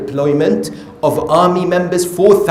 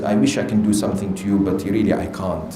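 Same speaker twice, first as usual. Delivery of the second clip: low, quiet, quick climbdown; backing down; 10 semitones lower, 8 dB quieter, faster than usual. Retold or imitated. Shimr to the soldier who challenged him, backing down. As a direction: retreat from the fight in a low, subdued voice.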